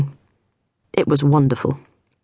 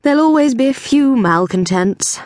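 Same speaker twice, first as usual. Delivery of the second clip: higher, airy dismissal; breezy observation; 8 semitones higher than usual. A narrator's reading. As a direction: wave it away lightly. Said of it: "observed Flack".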